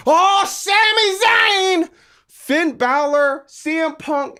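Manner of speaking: hammed-up delight at a big name; mock excitement. Mock excitement over returning stars.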